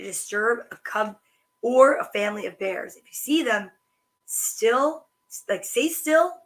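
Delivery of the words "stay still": ordinary delivery, nothing marked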